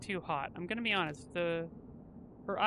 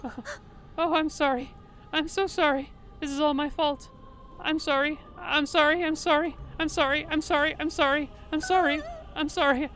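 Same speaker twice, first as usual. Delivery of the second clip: higher, louder, pleading over and over; guilt-stricken apologizing character; 5 semitones higher, 4 dB louder than usual. Acted. Streamer voicing the guilty character to Mango, desperate apology. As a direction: beg forgiveness, voice cracking with guilt.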